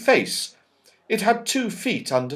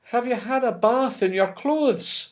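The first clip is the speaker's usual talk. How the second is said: higher, lighter fairy voice; high Silky voice; 9 semitones higher than usual. Acted. Silky teasing the soaked children, high and light. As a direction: tease them as gentle Silky. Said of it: "said Silky".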